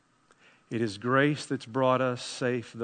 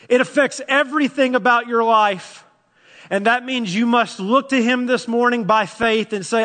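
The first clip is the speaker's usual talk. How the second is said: louder, urgent pleading sermon; fervent preacher; impassioned preaching; 10 semitones higher, 10 dB louder than usual. Acted. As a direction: press the listener to believe. Preaches at full force.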